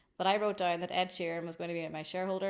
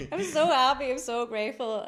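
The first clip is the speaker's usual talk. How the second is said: much louder, high, gushing joy; overjoyed tears; 7 semitones higher, 10 dB louder than usual. Retold or imitated. Telling friends why she is crying, loud gushing joy. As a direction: burst it out, overwhelmed with gratitude. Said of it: "I started crying".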